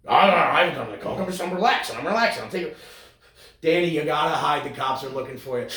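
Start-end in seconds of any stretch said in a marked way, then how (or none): 0.1-2.7 mock denial. Acting Danico denying.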